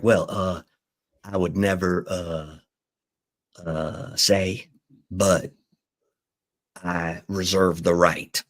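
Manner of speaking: slow, halting answer, slightly low; hesitant quote. Retold or imitated. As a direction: imitate someone choosing each word, with long pauses. Quoting Jerry's hesitant answer.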